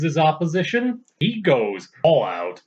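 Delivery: ordinary delivery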